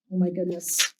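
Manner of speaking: usual speaking voice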